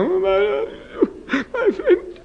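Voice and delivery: high, strained moan with breaking words; moaning groan. Retold or imitated. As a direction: moan it like a man groaning in prayer. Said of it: "He's talking like that, groaning".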